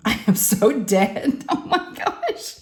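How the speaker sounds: laughing in disbelief, slowly drawn out; drawn-out words dissolving into high, breathless laughter; mock horror. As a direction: laugh through a horrified realization. Retelling her horror at the rip.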